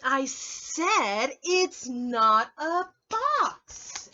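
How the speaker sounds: very slow, loud insisting; exasperated rabbit. Acted. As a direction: drag it out, fed up.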